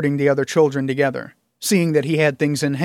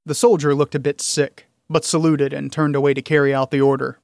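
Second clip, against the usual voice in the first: plain reading voice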